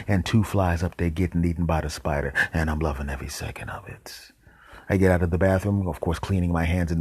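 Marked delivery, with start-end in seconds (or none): 2.5-4.3 whispered, unvoiced confession; whispered aside. Real, not acted. Narrator whispering his glee at flies being eaten. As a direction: hush it like a secret delight.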